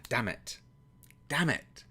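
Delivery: ordinary talk, nothing marked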